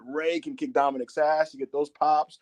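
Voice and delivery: ordinary talk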